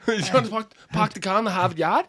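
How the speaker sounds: put-on high, challenging Boston accent. Comic in character testing his cohost, in a Boston accent. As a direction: question in broad Boston with dropped r's. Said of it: "if you wanna do a Boston accent".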